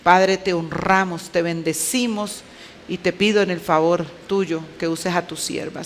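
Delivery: ordinary talk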